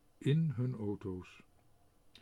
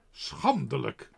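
morrend; 6 dB louder, slower than usual. A narrator's reading. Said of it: "morden de heren".

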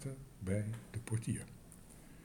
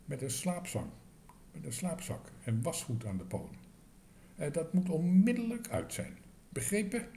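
stern scolding; 7 semitones higher, 4 dB louder than usual. A narrator's reading. Manager scolds the cook.

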